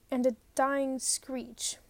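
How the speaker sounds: ordinary delivery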